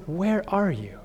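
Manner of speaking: soft, quiet, inviting; gentle question. Shown as a demonstration. God calling gently to hiding Adam, soft inviting question. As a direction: ask tenderly, not accusing. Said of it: "he doesn't say ... He says".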